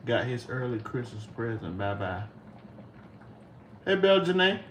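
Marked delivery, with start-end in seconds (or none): none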